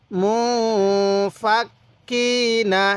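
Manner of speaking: slow, মু held as a hum and কি stretched; syllable-by-syllable lesson chant with long মু; model recitation. Shown as a demonstration. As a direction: hold মু, clip into ফাক, lengthen কি. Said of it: "এরকমভাবে শিখব".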